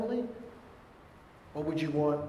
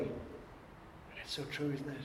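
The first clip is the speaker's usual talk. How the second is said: breathy hushed aside to the congregation; whispered aside; 13 dB quieter, slower than usual. Real, not acted. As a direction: drop to a whisper as if sharing a truth. Aside to congregation after quote.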